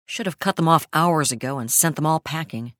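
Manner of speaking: ordinary delivery, nothing marked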